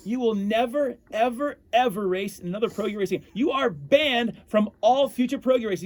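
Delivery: high, loud furious scolding; angry yelling. Retold or imitated. Stadium employee screaming at the mascot for diving, furious yell. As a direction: yell at him like an angry official. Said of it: "he starts screaming at me".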